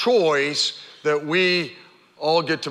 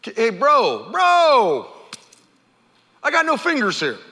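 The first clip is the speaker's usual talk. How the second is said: higher, pleading leper voice; pretend leper; 4 semitones higher than usual. Imagined leper pleading with Jesus, higher protest. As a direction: protest like a leper told to walk to town. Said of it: "think about if it was you".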